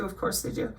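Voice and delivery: whispered under breath; sarcastic aside. Real, not acted. Reading acronyms, a whispered sarcastic mutter. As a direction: roll your eyes and mutter it. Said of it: "sarcastic aside".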